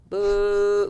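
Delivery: drawn-out boo at a moderate level. Retold or imitated. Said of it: "a little louder".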